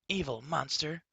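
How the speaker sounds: high, yelping outburst; shouting. A narrator's reading. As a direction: leap up and yell it. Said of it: "shouted Gorgie".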